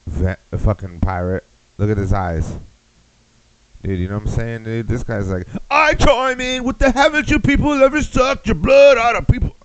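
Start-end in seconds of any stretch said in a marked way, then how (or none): 5.7-9.5 shouted way up high in a put-on voice; mock vampire line. Comic mocking a vampire who chimes in, high shout. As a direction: ham up a shrieking vampire.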